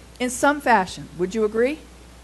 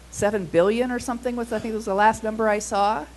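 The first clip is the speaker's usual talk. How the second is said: usual speaking voice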